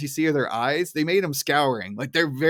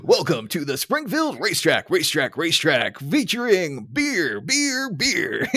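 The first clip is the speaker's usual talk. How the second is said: echoing racetrack announcer, repeats trailing off; 3 semitones higher, slower than usual. Pretend. Co-host performing his announcer bit on request, hammy announcer voice. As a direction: boom it out like a track PA announcer. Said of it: "Your announcer voice is awesome".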